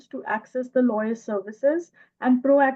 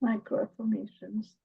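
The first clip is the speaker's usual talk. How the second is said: real shy, softer answer; 7 dB quieter than usual. Real, not acted. Student answering the teacher's prompt, shy and soft. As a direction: say it bashfully, holding the voice back. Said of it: "इतना शर्मा के क्यों बोल रही हैं".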